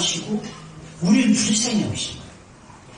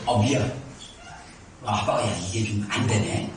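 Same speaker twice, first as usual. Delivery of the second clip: softly lowered, sheepish dad saying sorry; low gentle apology; 12 semitones lower, 6 dB quieter than usual. Retold or imitated. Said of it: "지금은".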